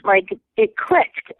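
ordinary talk, nothing marked

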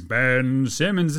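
"Ben Simmons" stretched out slowly; drawn-out name. Real, not acted. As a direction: linger on each word of "Ben Simmons".

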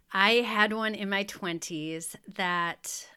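ordinary talk, nothing marked

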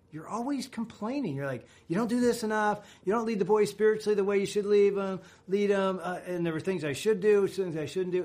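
high nagging mock voice; mocking complaint. Husband imitating his wife's endless complaints, high mock nag. [name] voicing Ann's complaints.

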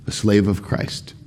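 ordinary delivery

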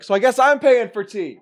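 high mock resignation; imitating bewildered companion. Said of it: "the other one's like".

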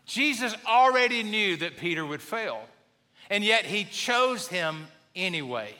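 ordinary talk

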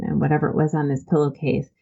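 usual speaking voice

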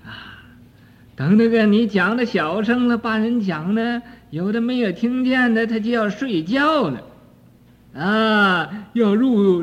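ordinary talk

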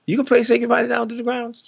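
very high, incredulous question; retold disbelief. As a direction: mimic someone sitting up in disbelief. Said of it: "he sat up and said".

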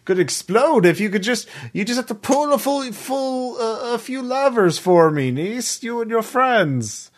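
high-pitched, hesitant pleading in character; wheedling uncle character. Acted. Uncle asks niece to pull levers, high wheedling voice. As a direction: coax them in a high, halting uncle voice. Game master plays the uncle.

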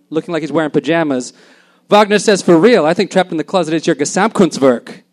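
loud, projected story reading; animated literary reading; story narration. Acted. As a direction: tell the tale out to an audience. Reads a story aloud.

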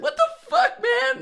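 very high, loud retold protest; high-pitched mock indignant yell; mock outrage. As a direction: squeal it like an outraged kid, hammed-up. Retelling his outraged childhood protest.

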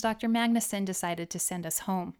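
usual speaking voice

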